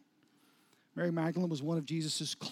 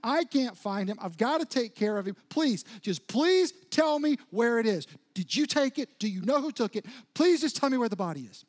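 louder, rising, desperate plea; panicked pleading; 3 semitones higher, 6 dB louder than usual. Acted. Mary begging the gardener for the body, panicked and loud. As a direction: beg him frantically, close to breaking down. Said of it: "And so in a panic".

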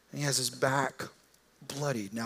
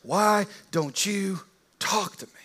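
slower, drawn-out "Why don't you talk to me?" from on high; lordly demand; 5 semitones higher, slower than usual. Retold or imitated. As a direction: loom over him and ask. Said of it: "with all this power saying".

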